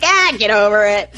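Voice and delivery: mock exasperated outburst; lightly hammed-up impatience; retold exasperated retort. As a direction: blurt it like brushing off a friend's fuss. Retelling own exasperated retort.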